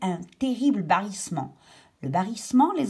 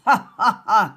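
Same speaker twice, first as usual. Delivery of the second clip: louder cackling laugh like a witch; witch cackle; 2 semitones higher, 9 dB louder than usual. Shown as a demonstration. Teacher explaining "ricaner" with a witch's cackle. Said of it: "comme une sorcière".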